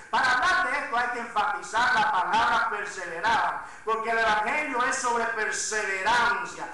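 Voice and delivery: loud, driving sermon delivery, words stretched for weight; full-force preaching. Acted. Pastor urging his congregation, loud full-force preaching. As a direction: press the congregation hard from the pulpit.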